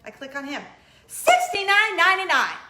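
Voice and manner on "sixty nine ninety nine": louder and higher, stunned at the price; shocked price read. Real, not acted. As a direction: blurt out the price in disbelief.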